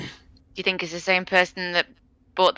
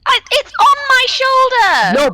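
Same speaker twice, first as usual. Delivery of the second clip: high, loud, drawn-out exclamation; alarmed cry; 8 dB louder, slower than usual. Acted. Player breaking in as it's on my shoulder, alarmed cry. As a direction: cry out in high-pitched alarm, stretching the words.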